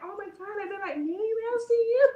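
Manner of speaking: high, fast incredulous question; can't believe what she heard; amused disbelief. Retold or imitated. Amused disbelief at being Minnie.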